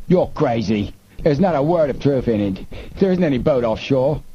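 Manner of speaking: crying out. A narrator's reading. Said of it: "now cried out".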